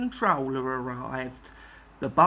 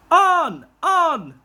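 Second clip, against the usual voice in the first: high, loud yells; yelling; 9 semitones higher, 8 dB louder than usual. Acted. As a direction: yell them on. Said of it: "they yelled".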